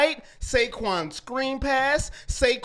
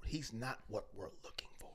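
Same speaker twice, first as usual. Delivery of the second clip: mocking whisper; 11 semitones lower, 23 dB quieter, faster than usual. Mocking the team's quote again.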